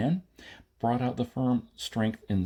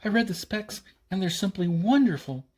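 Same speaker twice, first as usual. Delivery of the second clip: put-on much higher, gushing girl; delighted; 10 semitones higher, 3 dB louder than usual. Maida raves about the weapon specs, gushing and high. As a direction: rave like a child over candy. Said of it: "almost clapping her hands with happiness".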